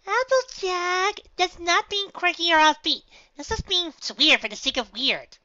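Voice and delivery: very high, loud, slow scolding; squeaky, drawn-out protest; bubbly pony objecting. Acted. Pinkie figure objects.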